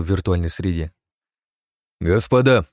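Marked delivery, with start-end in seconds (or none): 2.0-2.7 loud call for attention. Acted. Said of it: "произнёс он громко".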